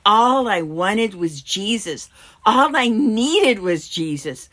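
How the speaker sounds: loud, rising declaration; fervent devotion. Acted. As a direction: build with heartfelt conviction.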